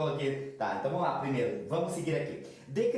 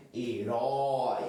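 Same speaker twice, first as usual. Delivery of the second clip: ordinary talk, nothing marked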